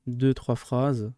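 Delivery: "Deux, trois phrases" said very slowly, word by word; slow repeat. Shown as a demonstration. Teacher repeats the summary length to pupils, very slowly. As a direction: spell it out slowly so pupils catch it.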